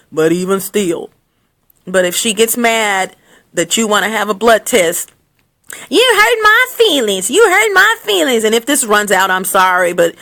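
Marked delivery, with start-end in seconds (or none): 5.9-8.4 high-pitched wounded whine; put-on, whiny indignation; mock hurt woman. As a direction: wail it like an overdramatic offended girlfriend. Voicing mad woman refusing test.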